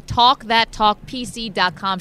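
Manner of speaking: slow, steady repeat of the web address; ad read. Acted. Repeated plug of website.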